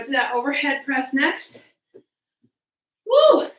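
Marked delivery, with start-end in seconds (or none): 3.1-3.5 high, loud whoop of effort; excited woo. Real, not acted. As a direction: cheer yourself on loudly. Cheering mid-workout.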